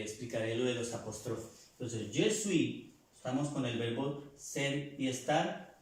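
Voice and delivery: ordinary talk, nothing marked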